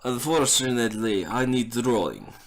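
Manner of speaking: broken-English Russian carver; heavy Russian accent in broken English. Acted. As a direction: woodcarver sends the adventurer to Tomkin, in a Russian accent. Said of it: "He's Russian now".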